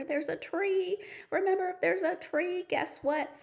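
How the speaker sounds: high excited teacher voice; bright and eager, building the surprise. Shown as a demonstration. Teacher thrilling the kids about the tree, high and excited.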